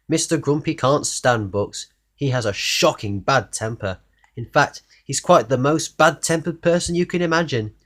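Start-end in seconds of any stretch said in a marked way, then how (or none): none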